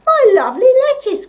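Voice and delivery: high, loud, fast dismayed cry. A narrator's reading. Mummy Rabbit sees her lettuce ruined, crying out. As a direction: wail over the ruined lettuce. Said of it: "cried Mummy Rabbit".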